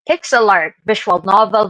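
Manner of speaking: ordinary talk, nothing marked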